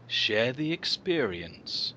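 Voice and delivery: soft, slow sultry ad read; slow ad voiceover. Retold or imitated. Quoting the cat food voiceover, slow and soft. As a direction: breathe it like a luxury advert. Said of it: "and the voiceover goes".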